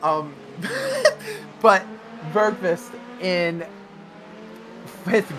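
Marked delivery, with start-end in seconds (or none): 0.4-1.6 high stifled giggle breaking out; can't hold back a laugh; suppressed laugh. Real, not acted. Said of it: "Sorry, I'm trying not to laugh".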